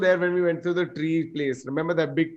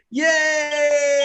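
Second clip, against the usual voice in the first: high cheer; excited cheer; 10 semitones higher, 3 dB louder than usual. Retold or imitated. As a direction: cheer like a carful of friends. Group cheer at trip start.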